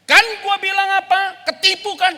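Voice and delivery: loud, high mocking I-told-you-so. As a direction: rub it in loudly. Mocking friend voiced, told you.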